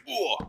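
high mimicked "uh"; short imitation hurt grunt; imitated Steve grunt. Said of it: "are loud as fuck".

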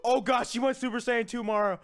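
usual speaking voice

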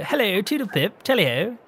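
put-on high-pitched toodle-pip posh accent; mock posh. Host protesting that's not how he talks, mock posh. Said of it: "I don't sound posh".

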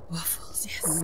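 breathless whisper of Waffles; a hushed, delighted whisper about waffles. Real, not acted.

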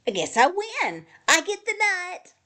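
pleased claim climbing high; delighted. A narrator's reading. Hedgehog thinks he wins the nut, delighted. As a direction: claim the prize, rising with delight.

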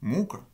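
muka with the weight on mu-; front-stressed muka, weak final syllable; stress on first syllable. Shown as a demonstration. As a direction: stress the mu- of muka, keep -ka light. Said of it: "avec l'accent sur la première syllabe".

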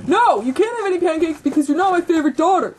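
loud, fast, high-pitched refusal; angry. Acted. Ken refusing his unfavored daughter, angry. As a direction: fire it off loudly.

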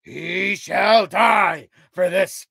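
slow, loud, high, grim vow; vow of vengeance. A narrator's reading. King swearing death to the murderer, slow and loud. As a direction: drag out each word like an oath. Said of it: "vowed Hrothgar".

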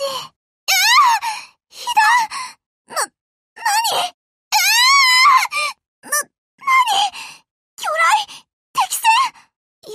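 high, loud startled shrieks; panicked, shrill surprise; shocked cries. Acted. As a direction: caught off guard by a hit. Hit by torpedo, shocked.

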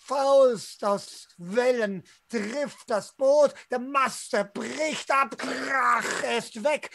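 ordinary talk, nothing marked

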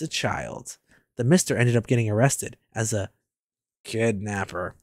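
low, soft inner-monologue read; subdued first-person narration. Acted. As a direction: narrate the boy's thoughts low and quiet. Reads the character's inner monologue.

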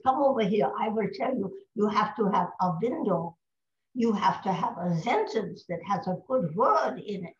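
put-on instructive German-accented coaching. Colleague teaches her the accent trick, German accent. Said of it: "supposed to do a German accent here".

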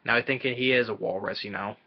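normal talking voice

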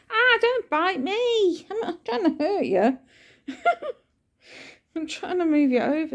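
owner soothing her cat in a high voice; soothing pet talk. Real, not acted.

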